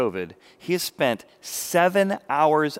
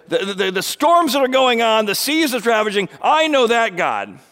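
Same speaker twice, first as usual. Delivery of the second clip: higher, louder proud boast; self-righteous boast; 7 semitones higher, 7 dB louder than usual. Retold or imitated. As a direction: boast about knowing God. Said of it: "here's a guy who said".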